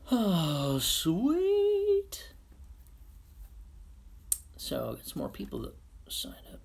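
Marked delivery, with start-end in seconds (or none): 0.1-2.3 high, loud squeal of delight. Real, not acted. Thrilled to see new viewers sign up, high squeal. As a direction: squeal it like great news.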